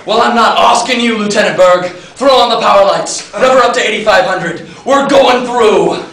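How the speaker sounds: firm heroic commander at full volume; commanding. Acted. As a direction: bellow orders at the crew over the noise. Commander overrules his officer.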